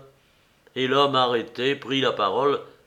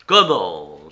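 loud, indignant outburst; exclamation; 7 semitones lower, 9 dB louder than usual. A narrator's reading. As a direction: cry out in mild disbelief, louder, pitch falling. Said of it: "s'écria le commissaire". (s'écrier avec indignation)

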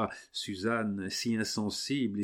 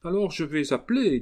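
threatening cry; 9 semitones higher, 6 dB louder than usual. Acted. Suzanne threatens to call out.

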